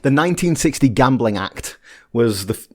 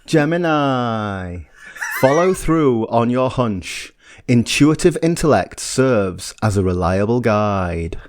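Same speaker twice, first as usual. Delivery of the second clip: very slow, serene mystic pauses; Mystic Meg voice; much slower than usual. Pretend. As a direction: intone it like a TV astrologer. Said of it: "can I do my Mystic Meg impression".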